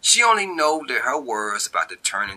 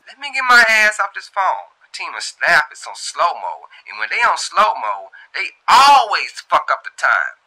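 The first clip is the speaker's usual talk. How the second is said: much higher and louder, fed up; exasperated worker; 9 semitones higher, 7 dB louder than usual. Acted. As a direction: complain about lazy coworkers. Shantae leaving call.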